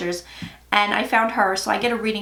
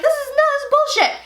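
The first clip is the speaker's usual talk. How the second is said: much higher, scoffing dismissal; dismissive disbelief; 3 dB louder, faster than usual. Retold or imitated. Rejecting the strange messages, a high scoffing retold dismissal. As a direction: brush it off as nonsense, pitched way up. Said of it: "I was like".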